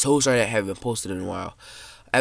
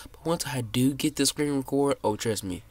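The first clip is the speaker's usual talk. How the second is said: ordinary talk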